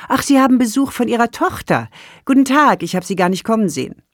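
a busy caring nurse chirping a greeting; cheerful nurse. Acted. Nurse greets visitor brightly.

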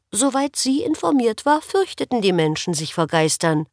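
ordinary delivery

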